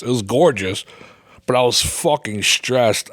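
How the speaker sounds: ordinary delivery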